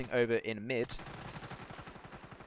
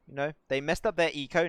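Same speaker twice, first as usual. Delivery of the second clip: usual speaking voice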